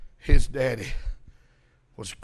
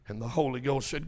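normal talking voice

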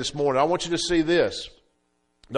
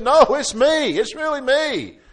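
insisting man; 10 semitones higher, 5 dB louder than usual. Retold or imitated.

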